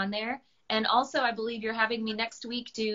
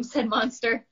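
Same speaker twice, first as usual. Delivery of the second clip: ordinary delivery, nothing marked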